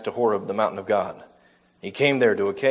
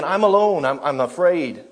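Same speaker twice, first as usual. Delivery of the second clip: mock Elijah; 4 semitones higher, 5 dB louder than usual. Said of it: "here he's saying again".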